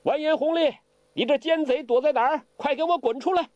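杨康提高嗓门喊话; loud challenge. Acted. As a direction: shout him out of hiding for show. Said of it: "声儿也大了".

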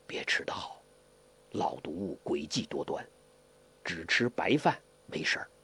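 low, near-whispered caution; hushed warning. A narrator's reading. Hong Qigong warns of poisoned food, in a hushed voice. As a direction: drop to a secretive undertone. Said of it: "小声地说".

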